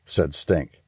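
plain reading voice

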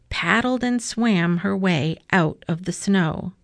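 usual speaking voice

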